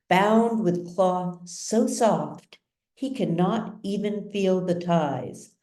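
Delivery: usual speaking voice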